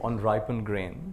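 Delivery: slow, measured reading of a poem, line by line; poem recitation. Acted. Teacher leads the audience through a memorized poem, slow recitation. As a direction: recite it slowly so the room can echo you.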